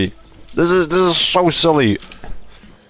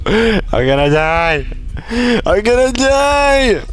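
mock panic; 8 semitones higher, slower than usual. Real, not acted. Panicking in fight.

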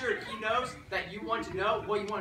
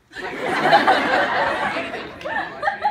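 very high, loud squeal; squeaky high voice; 14 dB louder, slower than usual. Shown as a demonstration. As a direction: shriek it out laughing. Squealing high laughing take.

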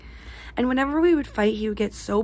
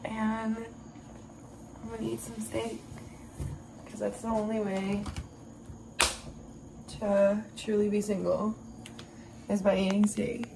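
hushed aside; 10 dB quieter than usual. Real, not acted. Hushed vlog aside about steak.

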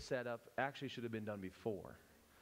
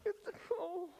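very high, hammed-up Southern cooing; Southern accent. Shown as a demonstration. Showing the class how mercy comforts with more fun, Southern accent. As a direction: squeal sympathy in an exaggerated Southern accent. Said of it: "Throw some accent".

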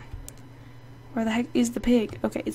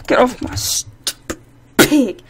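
slow, louder, stretched; angry order; 5 dB louder, slower than usual. Real, not acted. Gamer yelling at a rival on her pig, angry. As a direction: drag each word out in fury.

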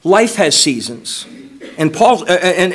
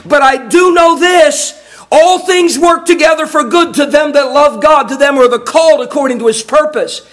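triumphant declaration; 7 semitones higher than usual. Acted. Job declaring faith triumphantly.

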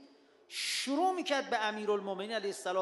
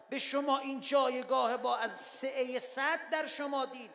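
ordinary talk

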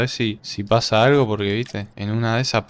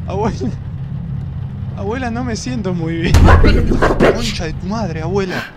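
panicking gamer; 7 semitones higher, 5 dB louder than usual. Real, not acted. Grandma ghost gets closer.

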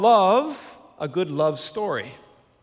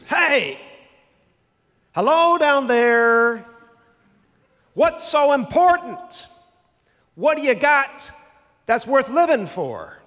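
put-on higher, louder thick Brooklyn accent; Miracle Max Brooklyn accent; 8 semitones higher, 7 dB louder, slower than usual. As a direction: ham up a thick Brooklyn accent, calling down to a body. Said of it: "in this real thick Brooklyn accent".

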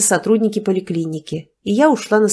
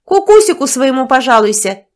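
higher, much louder mocking shout; shouted after her; 5 semitones higher, 7 dB louder than usual. A narrator's reading. Nastya shouting after the fleeing woman, mocking shout. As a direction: fling it after her down the hall. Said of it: "крикнула вслед Настя".

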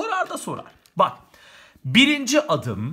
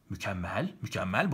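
sarcastic praise; 10 semitones lower, 9 dB quieter than usual. Real, not acted.

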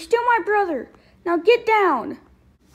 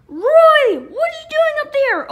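alarmed shout; 7 semitones higher, 8 dB louder, slower than usual. Acted. Seeing Roy on the ledge.

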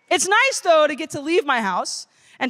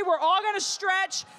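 usual speaking voice

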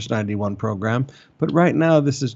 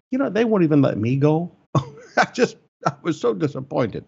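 amused, higher-pitched aside with a laugh; 3 semitones higher than usual. Real, not acted. Pastor joking he is not allowed to go, laughing. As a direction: laugh at your own disappointment. Jokes about being barred as senior.

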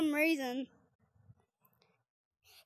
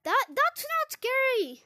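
higher, slower insisting it's not scary; mock brave; 7 semitones higher than usual. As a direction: fake bravado, pitched up. Repeats claim in higher voice.

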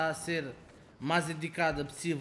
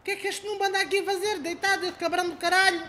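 mocking noob voice; 13 semitones higher, 3 dB louder, faster than usual.

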